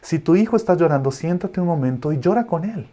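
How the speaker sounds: ordinary delivery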